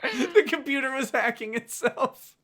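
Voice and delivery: high voice full of laughter; real giggly disbelief; laughing. Real, not acted. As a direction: get it out while cracking up. Cracking up at the book.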